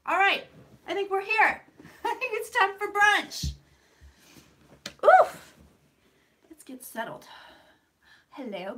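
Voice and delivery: ordinary talk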